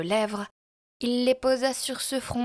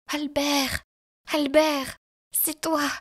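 high, breathless-with-emotion cry of recognition; choked with emotion; 5 semitones higher, 3 dB louder, slower than usual. A narrator's reading. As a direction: call the name high, overcome. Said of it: "suffoquée par l'émotion". (suffoquée par l'émotion)

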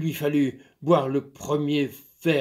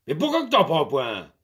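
lightly voiced farmer, firm and a bit louder; stern master; 2 semitones higher, 6 dB louder than usual. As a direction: lay down the law to the servant. Master questions.

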